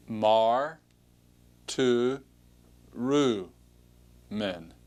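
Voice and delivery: "mar, tu, ru, men" with a long pause between syllables; syllable-by-syllable pronunciation. Shown as a demonstration. Teacher models the next Greek word, syllable by syllable. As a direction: sound out "mar, tu, ru, men", dropping on "men". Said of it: "Take a look at the next word".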